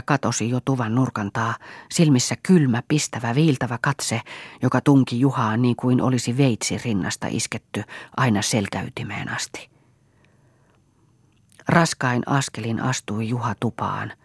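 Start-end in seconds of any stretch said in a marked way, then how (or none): none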